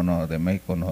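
ordinary talk

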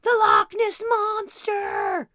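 put-on little girl yelling about monsters; screaming child. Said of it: "that little girl would have been like".

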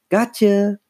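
high teasing exclamation; gleeful gotcha. A narrator's reading. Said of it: "Lindsay laughed".

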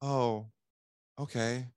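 meek, deflated acceptance; feeling sorry for himself. Acted. Salesman giving up on a lead, mildly self-pitying. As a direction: give in softly, a touch pitiful. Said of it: "we feel sorry for ourselves".

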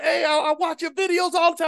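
high, strained fan holler; imitated yelling. Fan in a pickup truck hailing a video maker, imitated yell. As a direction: imitate a fan calling across traffic. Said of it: "we were yelling back and forth".

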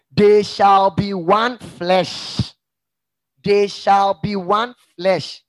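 loud, very slow verse proclamation; proclaimed scripture. Acted. Preacher quoting other Bible versions, slow loud proclamation. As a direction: declare each word slowly and forcefully.

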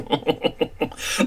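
soft, airy boastful chuckle; high, quiet run of chuckles; self-satisfied laugh. Acted. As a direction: laugh at your own importance. Boasting about close royal friends.

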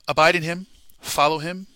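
loud high call to abide; shouted sermon call; fervent exhortation. Acted. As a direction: urge the congregation with fire. Rising exhortation.

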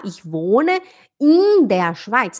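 "in" drawn out like "ihn"; slow answer with an exaggerated "eeen" for "in"; wrong long i. Shown as a demonstration. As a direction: hold "in" far too long. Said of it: "Tá errado falar in der Schweiz".

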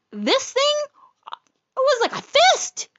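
usual speaking voice

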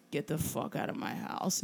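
low, hushed growly movie-star impression; Harrison Ford. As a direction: drop it low and soft, under the breath. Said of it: "in his most Harrison Fordy way".

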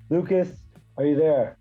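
usual speaking voice

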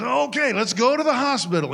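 high, fast, rushed; flustered excitement. Acted. Joe to Maria in labor, high and rushed. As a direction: jump into action, flustered and quick.

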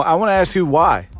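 ordinary talk

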